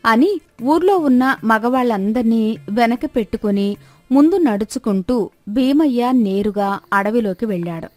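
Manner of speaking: ordinary delivery, nothing marked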